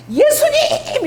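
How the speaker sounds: high-pitched eager cry; calling out to Jesus. Acted. Disciple wading off toward Jesus, calling out excitedly. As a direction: call out to the Lord, voice leaping high. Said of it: "이러고 가잖아".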